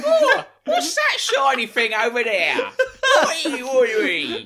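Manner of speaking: high, loud, slow laughing mock yokel; silly yokel voice. Cohost riffs on the vlogger with a daft yokel voice. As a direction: gawp and call out like a daft local.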